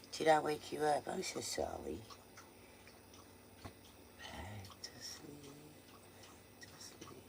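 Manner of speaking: soft whispered hush. Real, not acted. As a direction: gently whisper them back to sleep. Soothing a woken pet at night.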